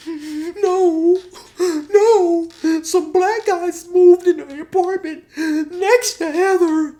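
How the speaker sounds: hammed-up falsetto bawling; Josh. Josh revealing his worry about Heather, exaggerated falsetto crying. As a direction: moan it out very high and loud. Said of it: "Josh is crying and stuff".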